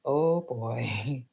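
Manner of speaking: low, soft uh-oh under the breath; a quiet sinking feeling at the folded grades; dreading oh boy. Retold or imitated. Sitting with my results, a low uh-oh. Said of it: "and I'm just like".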